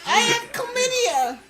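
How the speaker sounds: very high, loud, drawn-out squeal; mimicked koala voice. Mimics the koala line just played.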